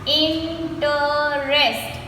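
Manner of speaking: "Interest" very slow as in-ter-est; three long beats on "Interest"; slowly broken up. Shown as a demonstration. Teacher counting three syllables, "Interest" slowly broken up. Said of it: "we say it slowly and we break the word".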